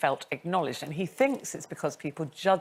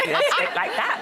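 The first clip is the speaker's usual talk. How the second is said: mock old-fashioned RP, higher pitched; received pronunciation; 5 dB louder than usual. Shown as a demonstration. Presenter mimics her younger self on TV, clipped RP accent. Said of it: "very much received pronunciation".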